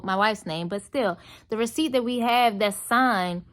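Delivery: ordinary delivery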